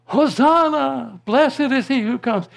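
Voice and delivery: ringing crowd shout of Hosanna; shouted praise. Retold or imitated. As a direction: cry it out like the crowd. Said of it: "they shouted".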